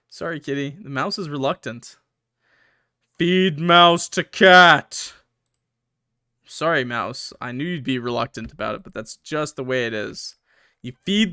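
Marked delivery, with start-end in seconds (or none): 3.2-5.1 very slow and much louder, dramatic; decisive choice. Shown as a demonstration. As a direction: announce the grim choice with weight.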